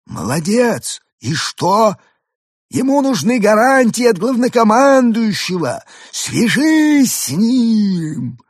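high, very slow alien order. A narrator's reading. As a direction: stretch it out like an alien. Arthur's alien voice. (высокий протяжный голос пришельца)

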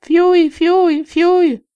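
high, shrill whistle blasts; whistle imitation. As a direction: blow it out like a gym whistle. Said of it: "с помощью пронзительного свистка".

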